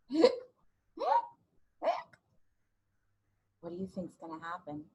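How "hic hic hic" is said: hiccups. Pretend. Imitating skeleton's hiccups.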